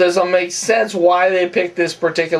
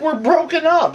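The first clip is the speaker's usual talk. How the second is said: higher, lightly voiced retort as Gianni; mock Gianni; 12 semitones higher than usual. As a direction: toss it back like the obvious answer, pitched up. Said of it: "He's like".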